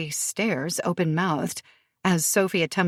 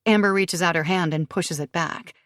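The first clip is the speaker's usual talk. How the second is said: usual speaking voice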